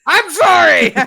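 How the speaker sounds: high, loud blurted sorry; shouted mock apology. Scolded co-host to friend, shouted mock apology. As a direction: yell sorry in a high pitch.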